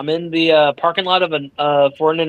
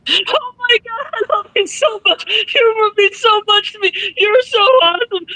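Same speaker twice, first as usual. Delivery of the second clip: imitating bawling. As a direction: blubber it out like an overwhelmed fan in tears. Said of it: "I am bawling and crying my eyes out".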